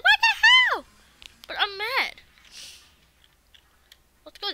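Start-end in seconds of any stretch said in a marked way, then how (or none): 0.0-0.8 replays the squeak, high falsetto; mock cracked-voice shriek; imitating his voice crack. Retelling his own startled crack, high squeaky imitation. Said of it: "I was like".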